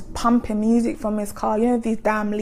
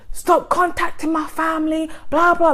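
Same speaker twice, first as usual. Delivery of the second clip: higher, raised-voice scolding; mock shout, right up in someone's face; imitated angry shouting; 7 semitones higher than usual. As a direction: retell an ex yelling, pitched up. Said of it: "just shouting at me".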